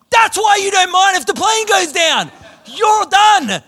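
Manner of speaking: high, loud comic outburst; acted outraged shout. Nervous flyer to seatmate with weeks to live, exasperated shout. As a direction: yell it as a sudden incredulous realisation.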